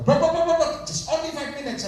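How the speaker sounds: high, insistent rapid-repeat appeal; retold urgency. Pastor alerts the owner's son about five minutes, high urgent retelling.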